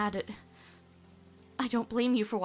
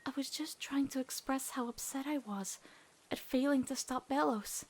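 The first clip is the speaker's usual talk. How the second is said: quiet regret; 8 dB quieter than usual. Acted. Luz confesses regret quietly.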